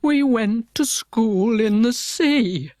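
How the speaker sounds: slow, teary telling; tearful Mock Turtle recalling school; still sobbing a little. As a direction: fight back sobs as you speak. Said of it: "though still sobbing a little".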